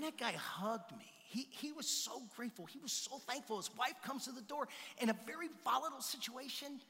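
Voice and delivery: ordinary talk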